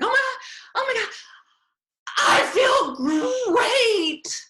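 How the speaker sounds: high, loud euphoric cry of relief; retelling a student's ecstatic outburst, very high and loud; overjoyed shout. Retold or imitated. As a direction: burst out with huge relief and joy. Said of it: "he was so overjoyed".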